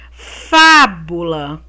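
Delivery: FÁBULA slowly, first syllable FÁ punched; stressed syllable shown. Shown as a demonstration. Teacher quizzing pupils on the strongest syllable, Fábula stressed on FÁ. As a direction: lean hard on the FÁ of Fábula. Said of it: "Qual é a mais forte?".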